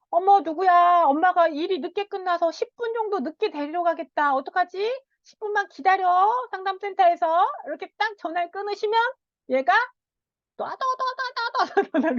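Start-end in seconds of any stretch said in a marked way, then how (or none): 0.1-7.5 higher, hurried apologetic mom; busy mother on the phone. Retold or imitated. Busy mother calling her son that she'll be late, hurried. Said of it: "엄마가 이렇게 전화하셔가지고". 10.6-12.1 high rattling burst imitating swearing; mock rapid cursing. Child bursting into a stream of curses, rattling.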